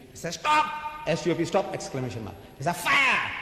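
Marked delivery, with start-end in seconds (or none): 0.3-0.7 sudden high-pitched shouted command; exclamation. Shown as a demonstration. Preacher showing how the Westerner exclaims, shouting. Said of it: "he ends with an exclamation". 2.8-3.3 high, urgent cry of alarm; exclamation. Shown as a demonstration. Preacher showing how the Westerner exclaims, calling out. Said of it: "he ends with an exclamation".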